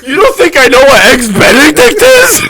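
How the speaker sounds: hint of a huffy coworker; lightly voiced mock outrage; mock offended.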